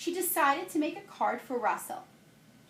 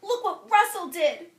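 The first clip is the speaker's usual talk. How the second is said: upset exclaim; 8 semitones higher, 7 dB louder than usual. Acted. Showing ruined picture to family.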